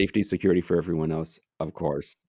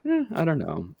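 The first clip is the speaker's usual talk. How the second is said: higher, shrugging "eh, I don't know"; mock indifferent kids; 10 semitones higher than usual. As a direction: play the careless kids who don't care. Kids shrugging off mouse warning.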